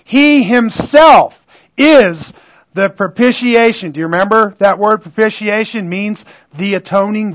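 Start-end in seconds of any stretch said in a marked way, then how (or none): 0.0-3.9 "himself" punched louder and higher; slow right down and hit "himself" hard; raised, forceful weight on "himself"; stress on himself. Shown as a demonstration. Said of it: "Emphasis on himself".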